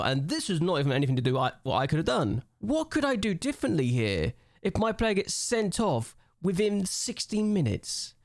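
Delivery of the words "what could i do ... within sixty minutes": whiny. Real, not acted. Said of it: "This is gonna be a whiny episode now".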